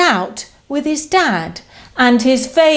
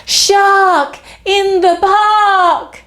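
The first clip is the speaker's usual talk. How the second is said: shouted warning, slowed; 8 semitones higher, slower than usual. Acted. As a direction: call it out to the park. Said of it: "He shouts".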